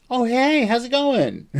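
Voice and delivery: high-pitched cheery hello; retold friendly greeting.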